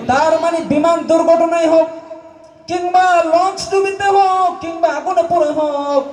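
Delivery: high, ringing list with stretched endings; impassioned preaching cry. Real, not acted. Preacher listing ways people may die, impassioned high cry. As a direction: cry out each item at a high pitch.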